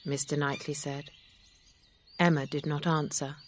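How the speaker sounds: ordinary delivery, nothing marked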